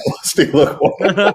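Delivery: says the nickname through a high laugh; cracking up at Lusty Laquan. Real, not acted. Guest repeats the host's silly nickname for him while laughing.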